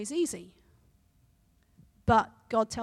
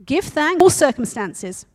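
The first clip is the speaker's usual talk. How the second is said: firm and louder, stressing all; correcting; 4 semitones higher, 13 dB louder than usual. Acted. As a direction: put her right firmly. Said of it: "Betsy corrected".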